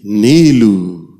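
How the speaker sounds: a slow, drawn-out call of the name from far away; mysterious calling voice. Retold or imitated. A voice in the night calls the boy, slow and drawn out. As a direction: stretch the name out like an unseen voice calling.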